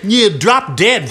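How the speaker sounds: slow, drawn-out bratty insult; slow rude insult. Acted. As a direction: stretch it out to annoy. Second girl drawls again.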